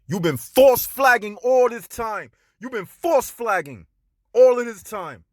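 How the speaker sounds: heated accusation aimed at Cynthia G; angry call-out. Real, not acted. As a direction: denounce her to her face. Calling out Cynthia G angrily.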